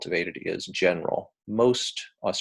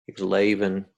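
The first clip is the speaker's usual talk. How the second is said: Aussie 'layvin' for leaving; demonstrated Australian accent; 4 dB louder than usual. Shown as a demonstration. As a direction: imitate broad Australian EE vowels. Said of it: "a little bit of an exaggeration".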